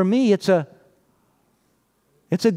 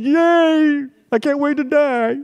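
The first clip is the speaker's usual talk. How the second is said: very high, louder mock cheer; hammed-up delight about dying; mock cheerful Lazarus; 9 semitones higher, 3 dB louder, slower than usual. Preacher voicing Lazarus to the congregation, hammed-up high cheer. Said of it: "And Lazarus said".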